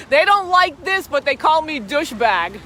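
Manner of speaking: loud mocking shout of the hecklers; mock yell. Vlogger mimicking hecklers who shout names at her. Angry passersby yelling insults retold.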